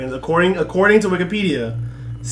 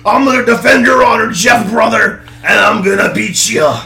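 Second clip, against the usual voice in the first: higher, louder hammed-up wrestler promo; Hulk Hogan impression; 6 semitones higher, 9 dB louder than usual. Cohost joins the Hogan bit, loud.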